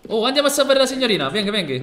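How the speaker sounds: high, loud and fast rallying cry; loud excited call to action; urgent call. Acted. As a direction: hurry everyone to the rescue. Rushing to save the lady.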